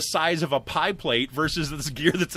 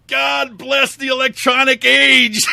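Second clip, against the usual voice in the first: loud, slow, higher mock hallelujah; mock grateful shout; 8 semitones higher, 10 dB louder, slower than usual. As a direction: proclaim it loud and slow like a hymn of thanks. Relieved outburst at electric grinder.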